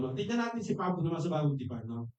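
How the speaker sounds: ordinary delivery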